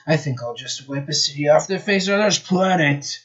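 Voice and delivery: put-on lightly villainous accent; gloating villain voice; Eggman voice. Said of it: "as I remember my accent".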